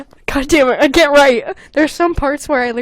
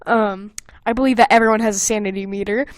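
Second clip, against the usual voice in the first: ordinary talk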